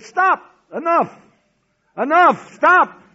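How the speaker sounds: high, loud bursts of command; retold shouted order. Nazis ordering the singers to stop, imitated shouting. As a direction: shout each word separately, acting the nervous guards.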